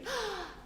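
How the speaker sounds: sharp mock gasp. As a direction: gasp in playful shock. Dramatic reaction, switching hands.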